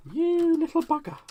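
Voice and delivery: high, loud squeal of mock outrage; playful high-pitched telling-off; mock scolding. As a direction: squeak it at a naughty little thing. Mock scolding a tool that failed.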